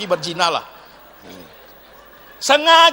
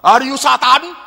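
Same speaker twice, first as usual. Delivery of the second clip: louder, jabbing accusation; accusing question; 5 semitones higher, 7 dB louder than usual. Retold or imitated. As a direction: fling the question at them. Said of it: "Atau orang tanya kita".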